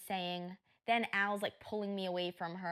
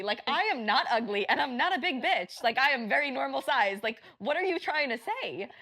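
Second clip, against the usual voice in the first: loud, raised-pitch protest; indignant retold comeback; 4 semitones higher, 8 dB louder than usual. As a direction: push back hard against the insult. Acts out indignant comeback to insult.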